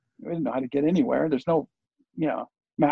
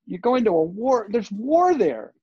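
higher, slowing alarmed mom voice, lightly acted; freaked-out mom; 6 semitones higher than usual. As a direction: imitate a worried mother hearing about a war. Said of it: "she's, like, freaked out".

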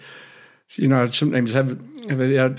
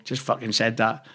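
ordinary talk

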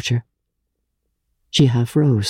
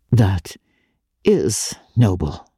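slight break in voice; 4 semitones lower, slower than usual. Acted. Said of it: "with a slight break in her voice".

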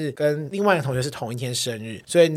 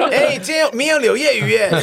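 high, loud delighted menu reading; gleeful kid; 7 semitones higher, 4 dB louder than usual. Retold or imitated. Kid thrilled by the lunch menu, giggling. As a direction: giggle over tomorrow's fish.

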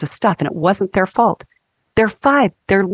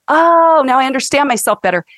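mock realization; 6 semitones higher, 3 dB louder than usual. Acted realization.

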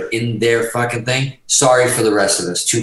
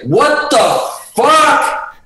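retold furious shout, much higher and louder; going off; 5 dB louder, much slower than usual. Bandleader storms onstage at the musician, outraged shout. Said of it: "going off".

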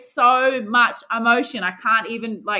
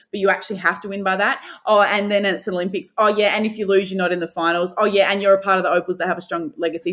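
ordinary delivery

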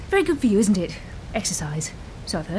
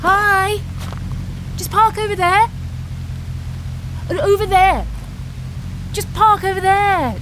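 calling out; 6 semitones higher than usual. Acted. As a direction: shout directions at a car. Directing car through rain.

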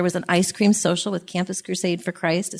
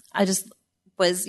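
usual speaking voice

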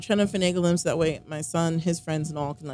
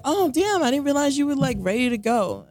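mock surprise; 7 semitones higher, 4 dB louder than usual. Imitating partner's sudden realisation.

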